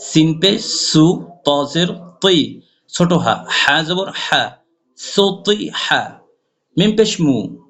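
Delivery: ordinary talk, nothing marked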